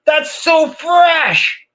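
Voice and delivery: hammed-up amazed dad to his son; mock excited. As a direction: gush over the toy like it's the coolest thing. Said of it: "and I'm like".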